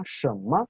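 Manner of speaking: "shenme" with "shen" rising in second tone; second-tone demonstration. Shown as a demonstration. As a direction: lift "shen" as in a question, light "me". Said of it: "segundo tono". ("shenme" en segundo tono, con "shen" ascendente)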